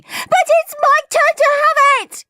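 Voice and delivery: put-on very high, loud child's protest; yelling. A narrator's reading. Peter lunging for the toy, yelling. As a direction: yell it in a piercing little-boy voice. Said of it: "yelled Peter".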